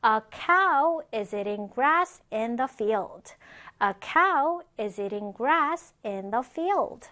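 slow, carefully spaced English example; slow model reading. Shown as a demonstration. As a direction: read it slowly for learners to follow. Teacher models example sentence for learners.

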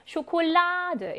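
쇼콜라드 with a long, high 라; teacher's slow demo of 쇼콜라드, stress on 라; stressed syllable shown. Shown as a demonstration. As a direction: slowly stretch the stressed 라 in 쇼콜라드. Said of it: "여기에 강세 있습니다".

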